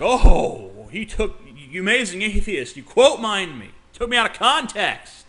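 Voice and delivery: loud whiny mock protest; hammed-up outrage, mocking his complaint; mocking Paul. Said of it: "Paul acts like".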